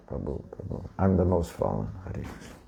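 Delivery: whispered, put-on meek "I'm the most fallen"; mock false humility. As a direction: parody a showy humble devotee in a hush. Mocking falsely humble devotee.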